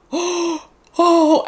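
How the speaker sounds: high, loud gasp into "oh"; shocked gasp. Retold or imitated. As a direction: re-enact being stunned by a surprise. Re-enacts shock at surprise party.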